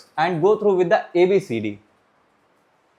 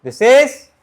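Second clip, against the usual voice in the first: ordinary delivery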